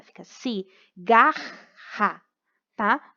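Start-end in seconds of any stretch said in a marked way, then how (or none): 0.2-2.2 cigarra split slowly between the two Rs; ci, gar, ra, each part of cigarra on its own; syllable by syllable. Shown as a demonstration. Teacher showing R R separates, cigarra in distinct slow syllables. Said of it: "Então, separa".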